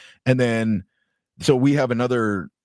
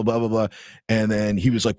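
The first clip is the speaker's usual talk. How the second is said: ordinary talk, nothing marked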